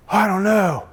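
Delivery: mock baffled drunk. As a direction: imitate a tough guy suddenly at a loss. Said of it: "And the guy goes".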